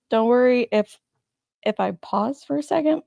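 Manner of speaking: usual speaking voice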